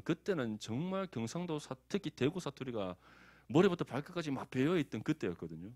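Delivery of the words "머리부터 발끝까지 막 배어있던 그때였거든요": ordinary delivery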